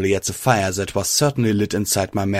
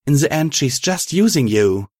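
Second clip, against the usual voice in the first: angry; 6 semitones higher than usual. Acted. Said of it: "glowed with anger".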